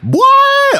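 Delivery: high yelp of surprise; surprised what. Real, not acted. Host surprised by the co-host's stat, high yelp. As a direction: shoot up in real surprise. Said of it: "Oh, they did the first game?".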